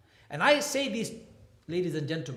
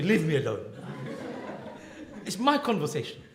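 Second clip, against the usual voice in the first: laughing quote. Retold or imitated. Retelling wife on phone.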